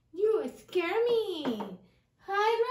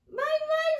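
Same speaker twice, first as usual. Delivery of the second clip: much higher puppet mouse goodbye; mouse says bye; 9 semitones higher, 5 dB louder than usual. Shown as a demonstration. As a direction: wave bye as the little mouse. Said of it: "Ray, you say bye-bye to our friends".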